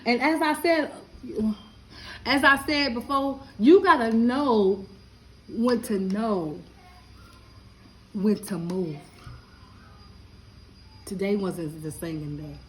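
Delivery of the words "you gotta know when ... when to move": slow preachy saying. Real, not acted. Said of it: "preachy slow saying".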